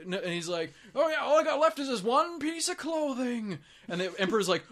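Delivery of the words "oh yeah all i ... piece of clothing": put-on high, lilting old man voice; happy old man. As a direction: imitate a cheerful old man, pitched up. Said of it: "some old guy on the side of the road who's happy".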